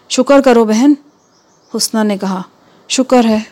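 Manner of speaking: plain reading voice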